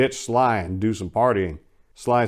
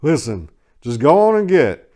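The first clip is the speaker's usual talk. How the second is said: firm, louder pushback; countering; 6 semitones higher, 6 dB louder than usual. A narrator's reading. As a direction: push back hard and loud. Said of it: "I countered".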